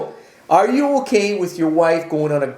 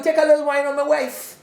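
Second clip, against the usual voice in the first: quoting a carnival guy; 8 semitones higher than usual. Retold or imitated. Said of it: "Some guys will tell you".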